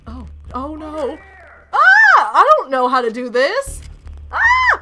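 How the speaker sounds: panicked shrieks and yells; frantic, high-pitched panic; panicking gamer. Real, not acted. Panicking at game controls.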